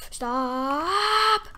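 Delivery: long "stop" rising high and loud; drawn-out yell. Real, not acted. Player yelling at an attacker, drawn-out rising shout. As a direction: yell it, stretched and climbing. Yells at opponent in game.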